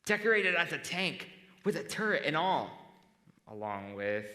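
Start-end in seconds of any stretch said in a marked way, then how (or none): 0.0-2.7 loud, high-pitched enthusiastic pitch of an idea; eager suggestion. Retold or imitated. Friend pitching a wheelchair Halloween costume idea, excitedly. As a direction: act out someone excitedly selling a wild idea. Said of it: "the ideas were".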